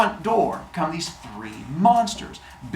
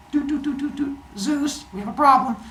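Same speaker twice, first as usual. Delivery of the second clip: higher, mock dial tone then call; oracle phone call; 10 semitones higher than usual. Oracle dialling up Zeus: high beeping call voice. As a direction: beep like a phone, then report.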